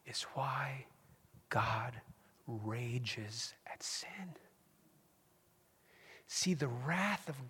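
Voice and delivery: slow, soft whisper building weight; very slow intimate whisper about the wrath of God; hushed preaching. Real, not acted. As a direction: draw them in with a whisper. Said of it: "preacher drops to whisper".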